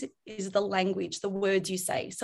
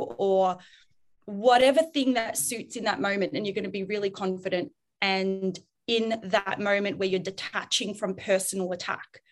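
ordinary talk

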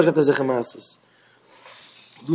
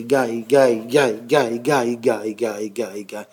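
low, repetitive chant like turning wheels; wagon wheel voice; 9 semitones lower, 6 dB quieter, slower than usual. Retold or imitated. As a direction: let the word roll round and round, low and fading. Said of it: "עס הערט זיך אזוי".

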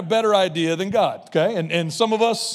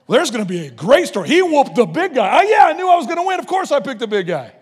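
much higher, loud triumphant crow; gloating brag; 6 semitones higher, 7 dB louder than usual. Retold or imitated. Boasting after winning the fight, high and gloating. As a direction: crow about beating the big guy.

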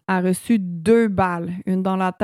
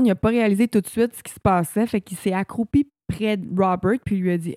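normal talking voice

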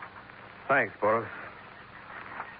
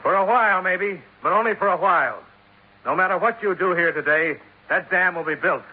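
raised, insistent voice; urgent; 6 semitones higher than usual. Acted. Dollar pleads with Pedro to stop, raised and urgent. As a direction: push back hard on the old man.

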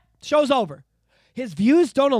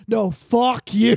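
mock drawn-out insult; 5 dB louder, much slower than usual. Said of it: "viewers going".

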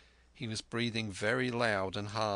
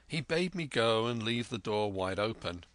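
usual speaking voice

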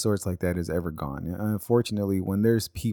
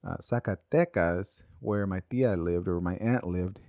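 ordinary delivery

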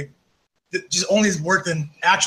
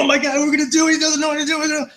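panicky writer; 10 semitones higher than usual. Retold or imitated. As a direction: imitate a flustered writer losing it. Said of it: "this guy's going".

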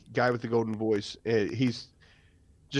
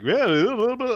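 high, louder mock radio voice; imitated golden voice; 10 semitones higher, 4 dB louder than usual. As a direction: imitate a showy radio announcer. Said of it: "dude actually is like".

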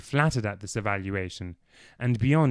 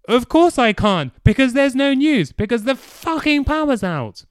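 angry yelling; 9 dB louder than usual. Acted. Clive's outburst continues.

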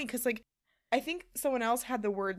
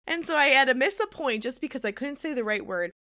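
ordinary talk

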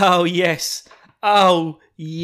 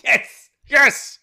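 much higher and louder triumphant yes; thrilled yes; 14 semitones higher, 9 dB louder than usual. Retold or imitated. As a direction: cheer it like a fan. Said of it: "every bit that happens is just like".

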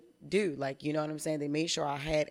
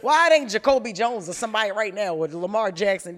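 much louder, higher incredulous protest; mock outrage; 5 semitones higher, 12 dB louder than usual. Real, not acted. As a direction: shout in comic disbelief. Mock outrage at son's answer.

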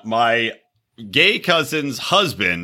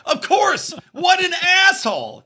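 mock outraged shout. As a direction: yell it out in mock disgust.

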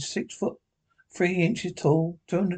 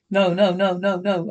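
louder run of no's; mild alarm; 3 semitones higher, 4 dB louder than usual. Acted. Sleeper waking from a bad dream, mildly alarmed. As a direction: protest quickly, lightly acted.

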